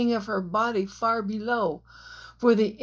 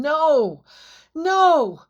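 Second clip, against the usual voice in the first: loud, higher shouts building; anguished shout; 7 semitones higher, 8 dB louder than usual. A narrator's reading. Jack shouting in tears over the cliff edge. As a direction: cry it out in grief. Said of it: "as he shouted again".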